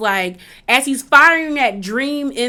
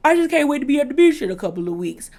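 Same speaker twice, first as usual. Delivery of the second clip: talking through a yawn, higher and rushed; 3 semitones higher, faster than usual. Retold or imitated. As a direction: imitate a man yawning as he talks. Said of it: "And he yawns".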